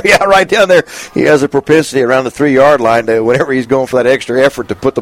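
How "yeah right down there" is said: amused, higher and louder repeat; laughing. Real, not acted. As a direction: laugh the words out. Repeats phrase, second time laughing.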